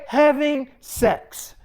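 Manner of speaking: slow, drawn-out defiant admission; deliberate confession. Retold or imitated. Daughter confessing to her mom, retold with slow defiance. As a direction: spell it out slowly, word by word.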